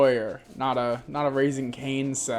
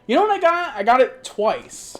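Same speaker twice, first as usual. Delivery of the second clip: excited reveal; 8 semitones higher, 5 dB louder than usual. Real, not acted.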